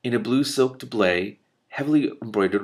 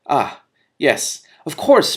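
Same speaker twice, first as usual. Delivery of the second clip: flustered; 6 semitones higher, 9 dB louder, much slower than usual. Acted. Flustered prince.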